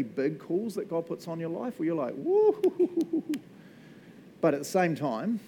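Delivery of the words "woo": high, loud hooting woo; awed woo. Retold or imitated. Preacher reacting to God's big call with a loud high woo. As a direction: let out an amazed woo at the huge call.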